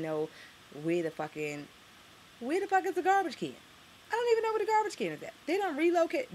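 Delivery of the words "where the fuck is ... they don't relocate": high, loud exasperated cry; incredulous outburst. Real, not acted. Vlogger ranting about relocated garbage cans, high incredulous cry. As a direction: shriek of disbelief, rushing.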